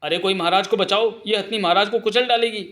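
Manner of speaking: high, alarmed plea; acted cry for help. Queen calling to save the king, high panicky plea.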